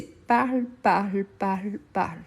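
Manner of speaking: "parle" ending on the L sound; silent endings. Shown as a demonstration. Teacher showing students the verb forms, "parle" ending on a clear L. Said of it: "je ne prononce pas".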